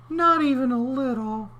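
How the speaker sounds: very high little voice; comic high clam. Acted. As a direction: squeak it as the clam.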